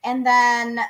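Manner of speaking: normal talking voice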